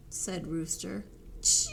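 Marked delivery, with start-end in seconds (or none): 1.4-1.7 long, high squealed cheep; shouted cheep. Acted. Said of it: "Rooster shouted into Turtle's open mouth".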